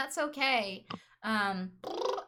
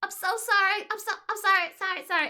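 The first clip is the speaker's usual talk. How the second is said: high, loud, panicked string of sorrys; flustered apology; 11 semitones higher, 9 dB louder than usual. Retold or imitated. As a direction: gush apologies in a sudden panic. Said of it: "going really angry to just".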